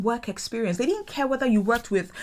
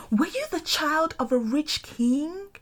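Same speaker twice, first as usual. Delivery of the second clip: high, patronizing mock surprise; condescending Londoner; 8 semitones higher than usual. Imitating Londoners praising her English, high and patronizing. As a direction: mimic their fake amazement. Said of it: "Sometimes they're like".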